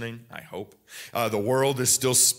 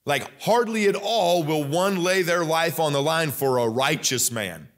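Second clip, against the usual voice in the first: normal talking voice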